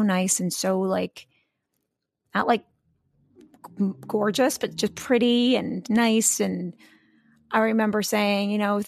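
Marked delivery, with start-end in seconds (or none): none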